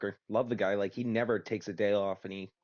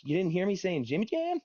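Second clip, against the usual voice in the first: high, incredulous mock question; retold question, pitched well up; imitating old dude; 9 semitones higher than usual. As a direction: lift it high as the puzzled caller.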